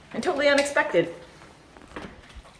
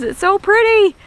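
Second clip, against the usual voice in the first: excited; 10 semitones higher, 4 dB louder than usual. Real, not acted. Admiring the hydrangea fields.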